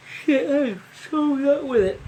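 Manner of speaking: high, loud frustrated cry at himself; exasperated outburst. Real, not acted. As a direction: blurt it out, annoyed at yourself. Frustrated self-scolding outburst.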